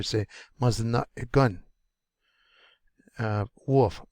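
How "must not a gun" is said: "Must not a gun" with air stopped after "not"; glottal stop. Shown as a demonstration. Teacher telling learners to stop their air, glottal stop mid-word. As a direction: cut the breath short before "a gun". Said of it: "stop your error right there".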